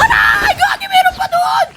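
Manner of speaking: high, yelping cry of mock pain. Real, not acted. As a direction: yelp as if shot. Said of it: "Ang sakit".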